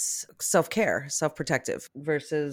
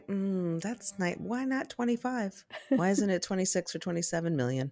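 softer, higher, grudging tone; mock unimpressed; 4 semitones higher, 5 dB quieter than usual. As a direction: sound let down by a fortune. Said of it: "people like that who might say".

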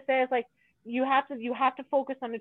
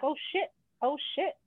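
mock alarm; 5 semitones higher, 4 dB quieter than usual. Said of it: "I was like".

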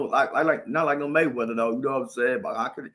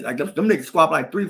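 normal talking voice

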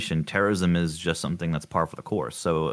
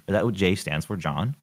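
ordinary delivery

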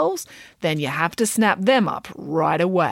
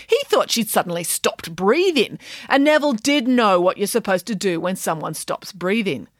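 plain reading voice

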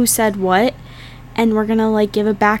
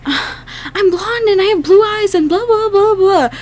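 higher, dead-serious imitation of her friend; mock serious tone; 5 semitones higher than usual. Friend mimics her serious Hitler youth complaint, mock solemn. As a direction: parody her grave complaint. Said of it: "you said it in such a serious tone".

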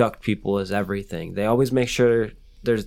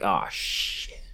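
breathy alarmed whisper; whispered oh shit; 4 semitones lower, 8 dB quieter than usual. Pretend. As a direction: hiss it under the breath. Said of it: "It's like".

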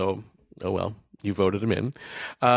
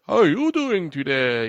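higher, put-on voice of the talking tree; 6 semitones higher than usual. Host imitating Woody the talking tree greeting kids. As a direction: greet the kid like a cheery mall Christmas tree. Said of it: "I don't know what that accent that was".

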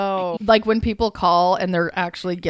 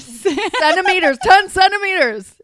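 higher, laughing through the word; laughing correction; 8 semitones higher than usual. Real, not acted. Helping her friend say the word while laughing. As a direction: giggle out the correction. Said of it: "Oh my God".

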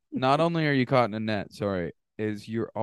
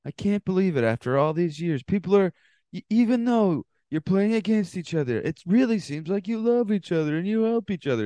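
higher, gushing TV-host voice; marvelling host, pitched up throughout; Jeff host impression; 7 semitones higher than usual. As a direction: ham up the awed reality host. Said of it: "the kind of shit that Jeff loves".

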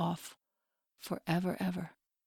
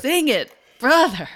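excited cheer; 8 semitones higher, 14 dB louder than usual. Real, not acted. Cheering on the guest's singing.

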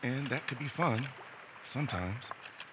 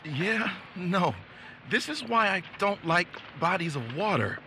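much higher, louder jittery griping; anxious complaint; 8 semitones higher, 8 dB louder than usual. Acted. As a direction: grumble through rising nerves. Anxious complaint about water.

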